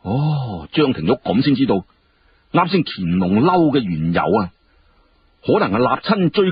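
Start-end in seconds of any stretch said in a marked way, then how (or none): none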